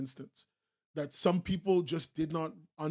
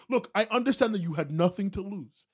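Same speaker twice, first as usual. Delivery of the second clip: normal talking voice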